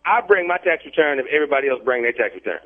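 normal talking voice

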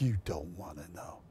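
very low, hushed mock-judge voice; Simon Cowell. Pretend judge refusing the host an answer, low hushed voice. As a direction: drop right down, soft and ominous. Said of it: "Well, Simon, tell me".

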